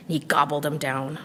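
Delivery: ordinary delivery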